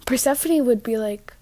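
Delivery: usual speaking voice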